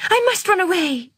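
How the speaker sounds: high, frightened self-urging; scared to herself. Acted. Girl telling herself to flee, high and frightened. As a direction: talk yourself into fleeing. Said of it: "she told herself".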